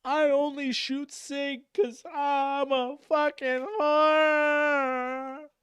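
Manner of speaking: high, slow mocking voice of a gun snob; mock gun-snob voice, high and drawn out. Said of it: "or the guy who's like".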